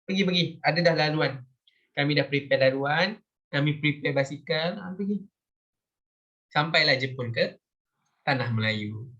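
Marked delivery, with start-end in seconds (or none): 0.0-5.2 pitched-up mock welcome, "go on, go on"; voicing Thailand. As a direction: playfully act out Thailand making way. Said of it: "Thailand pun macam".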